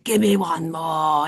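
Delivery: slow, drawn-out Scottish catchphrase; Scottish accent. Retold or imitated. Family catchphrase from the gym story, slow Scottish accent. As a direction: stretch it out in mock Scottish. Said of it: "You have to say it in Scottish accent".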